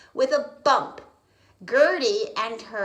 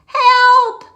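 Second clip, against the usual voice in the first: yelling; 18 dB louder than usual. Acted. Frog yelling in flood.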